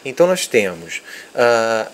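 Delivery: ordinary delivery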